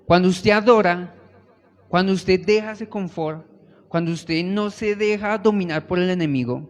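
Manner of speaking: loud, fervent preaching to the congregation; full-force preaching. Acted. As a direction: build the sermon to a roar.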